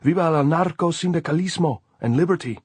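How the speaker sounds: high, loud rallying cry; shouting slogans. A narrator's reading. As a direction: shout your allegiance back. Shouted exchange of political slogans.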